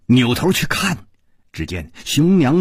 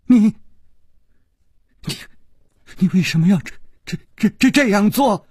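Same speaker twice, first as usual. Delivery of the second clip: higher, halting, stuttering in shock; trembling stammer; 8 semitones higher, faster than usual. A narrator's reading. Wounded Xiong Niangzi confronting her attacker, trembling. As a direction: gasp out each broken word. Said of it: "她颤声说".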